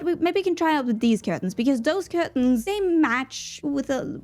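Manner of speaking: ordinary talk, nothing marked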